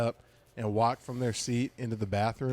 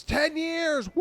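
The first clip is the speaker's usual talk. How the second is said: high-pitched mock whooping shout; drunken heckler cheer; 3 dB louder, much slower than usual. Drunk woman interrupting the comic's joke, whooping shout. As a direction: shriek it like a rowdy heckler.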